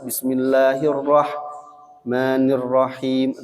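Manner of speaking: Bismillahirrahmanirrahim slow, leaning on each tasdid; stressed doubled letters. Shown as a demonstration. Teacher showing the tasdid, slow. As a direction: lean on every doubled consonant in Bismillahirrahmanirrahim.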